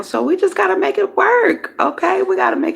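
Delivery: high, loud and upbeat; excited. Real, not acted. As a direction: pump it up, make it work. Cheerful push through bad setup.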